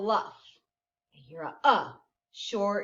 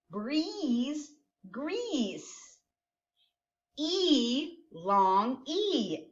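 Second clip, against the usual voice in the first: normal talking voice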